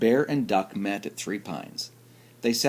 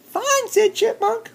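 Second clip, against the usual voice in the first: very high, tiny chipmunk voice; squeaky chipmunk voice; 5 dB louder than usual. Acted. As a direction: chirp it out like a little chipmunk. Chipmunk character voice.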